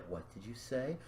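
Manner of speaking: very soft, low acted question; quiet menace. She turns around on him, quiet menace. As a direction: ask it dangerously quietly. Voices character turning round menacingly.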